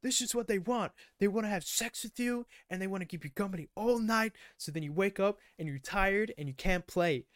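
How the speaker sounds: high, put-on lecturing voice; preachy jock lecture. Quarterback warning about the girls, high put-on lecturing. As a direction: lecture them in a high mock voice.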